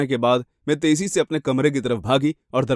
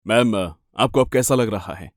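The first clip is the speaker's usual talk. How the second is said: lowered, official male voice; concerned policeman; 5 semitones lower than usual. Acted. Police officer checking on a shaken victim, low and composed. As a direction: ask evenly in a low voice.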